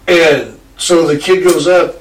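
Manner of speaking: ordinary delivery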